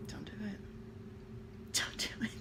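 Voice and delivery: hushed pleading whisper. Retold or imitated. Mother pleading to herself as son touches tummy, whispered. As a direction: whisper it under your breath, begging.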